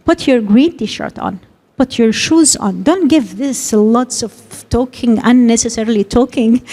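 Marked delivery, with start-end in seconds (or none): 0.0-2.8 slow, short, direct instruction; slow, clear order to the child to get dressed. Shown as a demonstration. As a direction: say only the task, slowly and plainly. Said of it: "go directly to the point".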